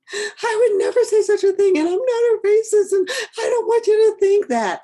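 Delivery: high, loud hammed-up sobbing denial; mock crying protest. Defensive person crying when told of a microaggression, loud mock sobbing. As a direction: wail it like a defensive crier who makes it about herself. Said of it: "when you start crying".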